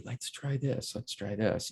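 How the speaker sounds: hushed imitation of a gentle director; quiet coaxing. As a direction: say it quietly, well under your voice. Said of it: "you know, quietly".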